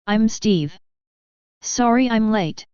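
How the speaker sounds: slow, shaky, emotional; trembling voice. A narrator's reading. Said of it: "said in a trembling voice".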